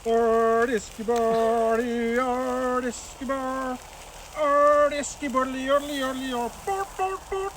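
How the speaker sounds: high, slow mock Swedish gibberish; Swedish Chef impression. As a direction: ham up the Muppet chef's babble. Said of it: "Who remembers the Swedish Chef?".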